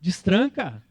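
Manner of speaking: ordinary talk, nothing marked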